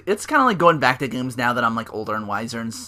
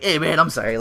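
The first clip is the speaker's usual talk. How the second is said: half-hearted apology; 4 semitones higher, 4 dB louder than usual. Retold or imitated. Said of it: "kinda half-hearted apology".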